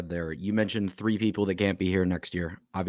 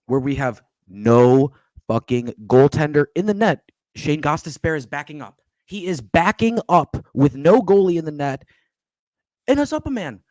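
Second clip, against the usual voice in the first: heated rant; 5 semitones higher, 7 dB louder than usual. Real, not acted. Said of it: "Sorry, that was a lot".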